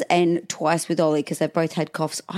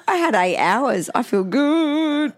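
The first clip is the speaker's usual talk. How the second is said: higher, drawn-out playful boast; mock gloating; 6 semitones higher, slower than usual. Mother boasts of eight hours' sleep, playful gloating. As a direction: stretch it out high, revelling in the sleep.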